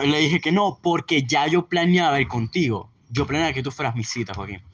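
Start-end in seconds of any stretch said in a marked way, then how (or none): none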